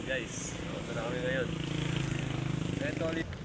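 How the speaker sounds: hushed voice, hiding so they won't hear; whispering. Real, not acted. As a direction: keep it to a secret whisper. Hiding in back, talking secretly. (pabulong na boses)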